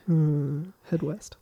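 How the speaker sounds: very soft, mumbled aside; mumbling. Retold or imitated. Podcaster imitates the professor avoiding a question, mumbling. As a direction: mumble it off, trailing away. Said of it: "Starts mumbling off and trailing off".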